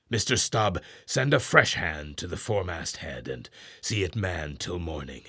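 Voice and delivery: firm orders fading to a low murmur; command, then reason quietly; captain's orders. Acted. Ahab gives Starbuck orders for the night, firm then low.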